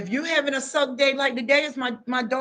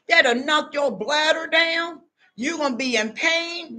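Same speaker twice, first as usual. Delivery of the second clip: normal talking voice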